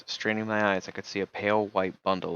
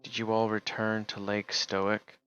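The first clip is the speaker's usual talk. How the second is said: usual speaking voice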